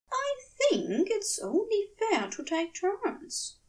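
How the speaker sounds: coy whisper, testing the waters; breathy shy angel. Acted. Angel shyly asking about spooning, half-whispered.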